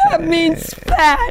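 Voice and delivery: high, drawn-out wounded retort; mock dismay. Young woman answering her friend's euphemism, dismayed. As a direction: wail it slowly in comic hurt.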